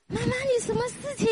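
put-on high, soft child asking mama; child's voice. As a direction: voice a worried little child. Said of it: "他就说".

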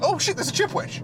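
high surprised gasp; delighted discovery. Pretend. Acted surprised find.

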